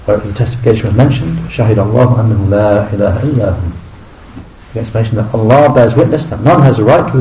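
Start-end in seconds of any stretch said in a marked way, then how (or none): 1.5-3.7 measured Quranic recitation voice; Arabic verse chanted in tajwid style. Acted. Quoting the ayah in Arabic.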